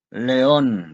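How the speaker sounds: sharp, loud call of his name; angry. A narrator's reading. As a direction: snap his name in annoyance. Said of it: "respondió ella enfadada". (enfadada, nombre dicho en voz alta)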